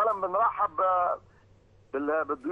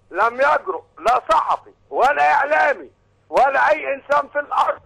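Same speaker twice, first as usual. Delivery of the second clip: loud, high caller shouting praise; shouting over a phone line; 4 semitones higher, 7 dB louder than usual. Real, not acted.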